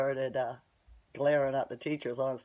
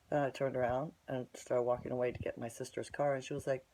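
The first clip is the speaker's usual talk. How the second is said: usual speaking voice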